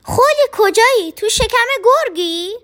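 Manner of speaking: loud anxious call. A narrator's reading. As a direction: shout the name into the distance. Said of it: "فریاد زد".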